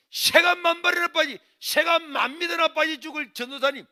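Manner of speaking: high, loud dialect curse; cursing mother. Retold or imitated. Mother cursing the church evangelist, furious shouting. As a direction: hurl curses at full voice. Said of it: "그 전도사님 욕을 하면서".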